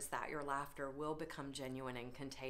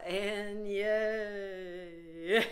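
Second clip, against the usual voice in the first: louder, long-held yay; drawn-out cheer; 2 semitones higher, 5 dB louder than usual. Shown as a demonstration. Yoga leader ending the group chant, long cheering yay. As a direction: cheer it out and hold the vowel.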